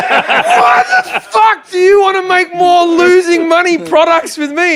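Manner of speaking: high, loud, slow laughing yell of disbelief; incredulous shout. Retold or imitated. Comic retelling to his distributor, a high laughing shout. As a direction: howl it out in outraged disbelief while laughing.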